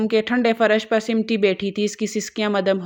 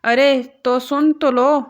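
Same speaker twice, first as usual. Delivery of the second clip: louder, higher, slow exasperated plea; irritated; 5 semitones higher, 4 dB louder, slower than usual. A narrator's reading. Son snaps at his family to just listen, irritated. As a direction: make them listen, exasperated. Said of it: "جنجلا کر کہا".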